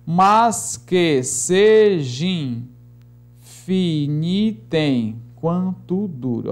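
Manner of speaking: slow, evenly stretched verse; chant-like metrical reading; syllable-by-syllable scansion. Shown as a demonstration. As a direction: give every syllable its own long beat. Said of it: "vamos escandear aqui alguns versos".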